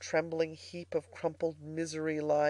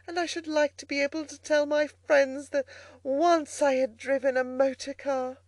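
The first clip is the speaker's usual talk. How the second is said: higher, eager pleading voice; wheedling Toad; 7 semitones higher, 4 dB louder than usual. A narrator's reading. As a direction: coax sweetly for a turn. Toad coaxes driver as washerwoman.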